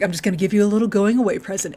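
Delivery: fast, breezy offhand line; quick Q. Retold or imitated. Host voicing Q handing Data a present, fast. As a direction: rattle it off casually. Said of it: "he's like".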